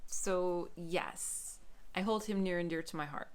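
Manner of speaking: ordinary talk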